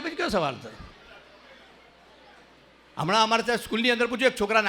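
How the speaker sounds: usual speaking voice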